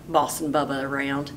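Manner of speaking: ordinary delivery, nothing marked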